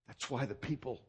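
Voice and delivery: normal talking voice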